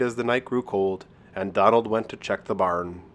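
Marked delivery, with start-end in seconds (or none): none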